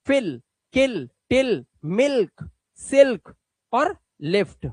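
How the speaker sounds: slow, deliberate model words; slow clear word list. Shown as a demonstration. Teacher modelling short-i words for students. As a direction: spell each word out slowly. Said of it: "ये जल्दी बोला जाता है".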